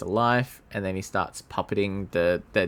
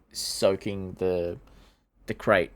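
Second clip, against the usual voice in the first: normal talking voice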